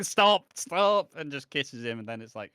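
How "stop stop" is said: high, loud protest between laughs; laughing stop. Retold or imitated. Gina stopping Harry's dumb dance, high giggly protest. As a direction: beg him to quit the silly dance. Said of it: "and then Gina's like".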